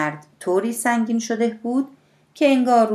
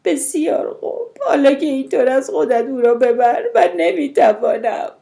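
high, quavering cry, growing louder; trembling voice; 7 semitones higher, 6 dB louder than usual. A narrator's reading. Mother telling her sister to take the baby, voice trembling. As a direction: give in with a trembling, overwrought voice. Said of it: "مادر با صدای لرزان گفت".

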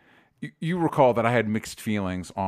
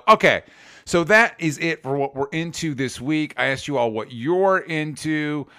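ordinary talk

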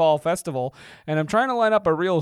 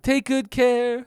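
slow, higher silly voice; slow put-on voice; 6 semitones higher, much slower than usual. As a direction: drag it out like a chant. Echoing the silly voice.

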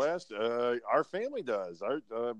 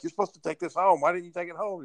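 imitated scolding, pitched up; higher-pitched mock reproach; fed-up teacher; 6 semitones higher than usual. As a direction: voice a teacher lightly telling off a kid. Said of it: "she finally had enough of him".

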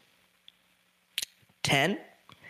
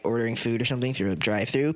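ordinary talk, nothing marked

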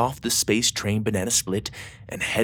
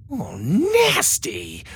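disgusted; 7 semitones higher, much slower than usual. A narrator's reading. Disgust at alien fur.

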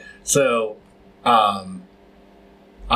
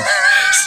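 short loud breathy laugh; loud laughing burst; 5 dB louder than usual. Real, not acted. Shouting out his friend, laughing loudly. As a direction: blurt a laugh.

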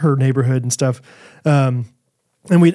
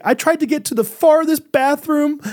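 put-on high, fast, upset child's voice; a high, upset little girl's voice; 11 semitones higher than usual. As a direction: imitate a scared girl explaining. Voicing frightened daughter.

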